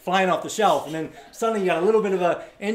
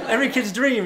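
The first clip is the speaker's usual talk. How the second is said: loud, high, insistent plea; hammed-up protest; mock pleading; 7 semitones higher, 7 dB louder than usual. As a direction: argue desperately to stay. Acted protest against leaving circus.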